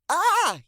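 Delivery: high, loud startled yell. Acted. Janie yells as the big dog leaps into her lap. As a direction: yelp as the dog lands on you. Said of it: "yelled Janie".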